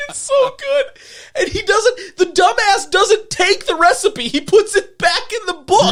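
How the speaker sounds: high-pitched, laughing through words; giggling while telling it. Real, not acted.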